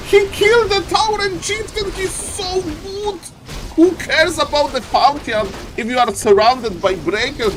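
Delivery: high, squealing praise; thrilled caster. Real, not acted. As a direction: gush over the play at full excitement. Caster thrilled by hero kill.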